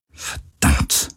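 soft, voiceless mutter; murmured curse. A narrator's reading. Captain with eyes shut, murmuring a curse. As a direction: curse under the breath. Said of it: "murmelte sie immer wieder".

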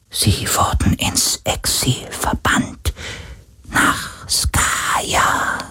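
soft, slow unvoiced spirit whisper; a grudging ghost, barely audible; poltergeist. Ghost continues.

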